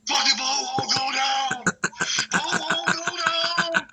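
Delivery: put-on long, high drunken wail; mock scream with drawn-out high words; imitated screaming. Drunk neighbour struggling with his canoe, imitated high scream. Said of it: "He's screaming".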